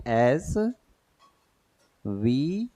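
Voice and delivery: एसबी drawn out very slowly, letter by letter; sounding out SBI. Shown as a demonstration. Teacher sounding out एसबी slowly for the class. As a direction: stretch एसबी slowly so each letter is heard. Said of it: "एसबीआई मतलब कैसे पढ़ेंगे?".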